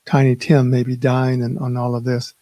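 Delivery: usual speaking voice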